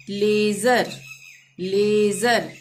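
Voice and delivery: लेजर with the ए stretched long; careful model लेजर, long ए; drawn-out ay. Shown as a demonstration. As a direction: hold the first vowel of लेजर. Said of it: "खींच के बोल रहे हैं ना".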